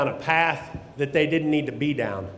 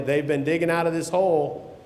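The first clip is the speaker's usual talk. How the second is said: ordinary talk, nothing marked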